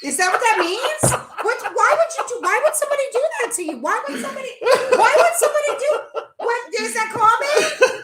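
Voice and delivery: high, laughing disbelief; real high-pitched astonished questioning; incredulous. Real, not acted. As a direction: ask in baffled amazement, breaking into laughs. Incredulous, laughing at the punching story.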